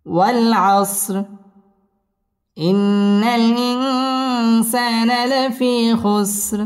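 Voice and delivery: slow, drawn-out melodic recitation; Quran recitation. Shown as a demonstration. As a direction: chant it slowly, tajweed style. (ধীর সুরেলা কুরআন তিলাওয়াত)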